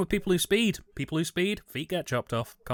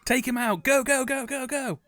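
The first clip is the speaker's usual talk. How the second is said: excited cheering; 9 semitones higher than usual. Pretend. Cheering at the screen.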